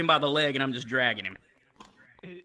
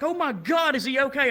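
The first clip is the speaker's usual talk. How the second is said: high alarmed gasp of concern; alarmed friend; 8 semitones higher than usual. Retold or imitated. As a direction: rush it out worried. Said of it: "It's like".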